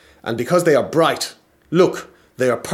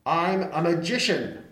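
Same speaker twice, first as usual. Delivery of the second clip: magician voice; 6 semitones higher, 5 dB quieter than usual. Acted. Magician voice at the door.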